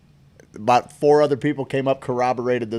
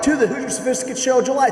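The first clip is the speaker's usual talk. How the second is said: announcer voice; 9 semitones higher than usual. Acted. Announcing the show name.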